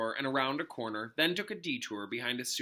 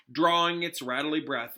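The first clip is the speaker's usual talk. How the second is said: ordinary delivery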